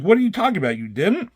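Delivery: hammed-up disbelief at the accusation; baffled disbelief. Reed Richards, hearing the claim, half-hearted baffled protest. Hero baffled in retold scene.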